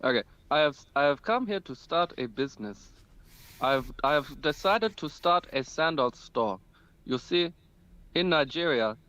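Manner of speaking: put-on Nigerian accent, measured delivery; African accent. Prankster pitches his sandal store, put-on African accent. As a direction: fake a Nigerian trader's accent. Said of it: "Do another African accent for us".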